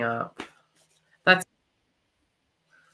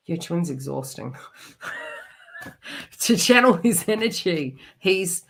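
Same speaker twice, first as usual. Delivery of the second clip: ordinary talk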